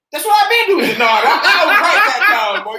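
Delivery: put-on high, loud protest of a kid being corrected; loud, high-pitched mock indignation. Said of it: "He said".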